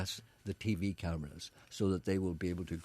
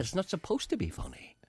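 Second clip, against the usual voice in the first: unvoiced, hushed repeat; whispered again, half amused; 9 semitones higher than usual. Real, not acted. Repeat after "What?.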